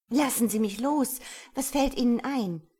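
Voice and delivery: loud, high outburst; indignant protest. A narrator's reading. Woman to the man who grabbed her, indignant protest. She struggles in his embrace.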